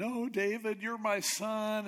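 high-pitched, mildly hammed-up weeping; mock crying. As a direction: plead as if tearful, voice lifted high. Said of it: "And he's crying".

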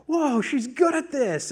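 high, loud, stretched-out astonishment; re-enacted proud amazement; amazed dad. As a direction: marvel slowly, pitch lifted. Amazed dad watching daughter surf.

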